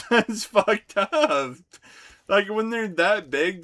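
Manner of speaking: loud, high, slow and drawn out; big amused yell; laughing outrage. Real, not acted. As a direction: shout it out through a laugh.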